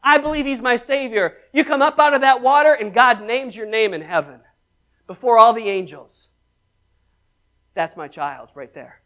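ordinary talk

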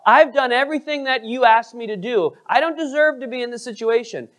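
imitating an indignant, entitled plea; entitled protest. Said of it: "Is that his attitude?".

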